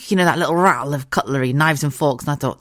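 normal talking voice

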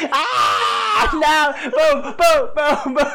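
high, loud cry with imitated gun booms; mock shot victim. Co-host acting out getting shot down, mock screaming. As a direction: yell and boom through laughter.

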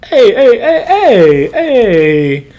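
loud, high-pitched string of heys; boisterous hailing. Acted. Mob guy's boisterous greeting, retold.

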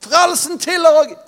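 high loud cry of praise; shouted out. Acted. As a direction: shout it as the crowd did. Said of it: "De ropte det ut".

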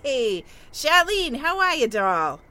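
hammed-up street greeting to a friend; calling out. Patty hailing Charlene down the street, loud and drawn-out.

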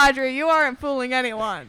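loud call right on the mic; yelling into mic. Real, not acted. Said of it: "Stop yelling into the mic".

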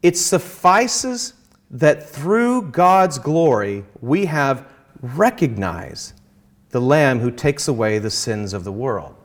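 slow, deliberate reading of the quote. Retold or imitated. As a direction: read it out slowly, weighing it. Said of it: "He says".

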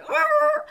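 sudden high panicked cry; panicked yell. Retold or imitated. Speaker freezing on stage, high panicked cry. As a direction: blurt a high yelp of panic. Mind going blank on stage.

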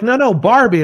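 animated quote of insistent locals; mock insistence. Retold Australians correcting his confusion.

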